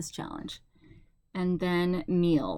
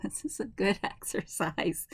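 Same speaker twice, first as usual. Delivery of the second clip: whispered with a laugh; 3 dB quieter than usual. Real, not acted. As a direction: chuckle it under your breath. Laughing at the tiring scratching.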